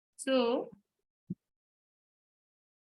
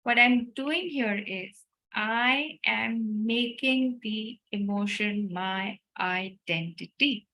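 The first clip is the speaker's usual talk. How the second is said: ordinary talk, nothing marked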